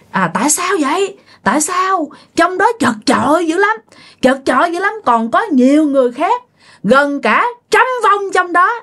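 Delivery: put-on high, hoarse ghost of an old man; hoarse old man spirit. Possessed aunt demanding answers from the family, hoarse old man voice. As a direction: let a trapped old spirit rasp through the aunt. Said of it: "giọng khào khào, giống như là giọng của một ông già".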